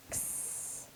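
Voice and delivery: "X" as a voiceless, soft "ks"; isolated letter sound. Shown as a demonstration. Teacher isolating the next sound for spellers, "X" as bare "ks". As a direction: make only the "ks" sound of "X", no vowel. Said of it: "the next sound I hear is".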